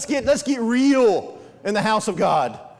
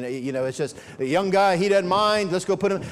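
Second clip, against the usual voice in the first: ordinary talk, nothing marked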